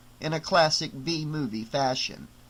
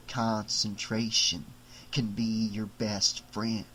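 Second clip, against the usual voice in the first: lower, slow doll voice, puzzled in the dark; a small doll muttering to himself, slowly; trapped doll; 5 semitones lower, 6 dB quieter, slower than usual. Acted. Doll musing smugly.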